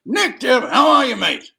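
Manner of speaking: high, loud, hearty call down the phone; hearty greeting. Retold or imitated. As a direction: boom a cheery hello to a new mate.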